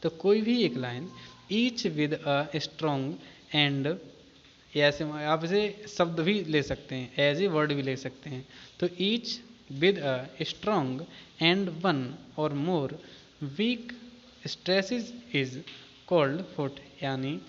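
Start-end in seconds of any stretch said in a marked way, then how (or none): 1.5-4.0 slow dictation. Shown as a demonstration. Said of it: "इसे आराम आराम से समझें". 8.9-17.0 slow dictation. Shown as a demonstration. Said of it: "इसे आराम आराम से समझें".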